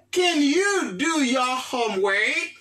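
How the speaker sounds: slow, drawn-out English for the class; slow model phrase. Shown as a demonstration. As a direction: spell it out slowly for learners. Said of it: "teacher models English phrase". (fraz anglè dousman)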